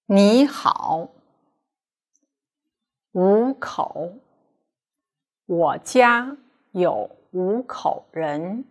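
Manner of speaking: slow, careful 我家有五口人 for students to repeat; model reading for repetition. Shown as a demonstration. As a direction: read it out like a teacher leading drills. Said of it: "Please read after me".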